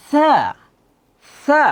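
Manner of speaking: tongue tip between teeth for "সা"; thin letter. Shown as a demonstration. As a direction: model Arabic "সা" with a light th. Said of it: "একটু পাতলা হবে".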